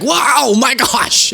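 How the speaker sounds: high-pitched mock astonishment; hammed-up "oh my gosh" reaction; mock shock. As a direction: exclaim it in an exaggerated high voice. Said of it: "It was like".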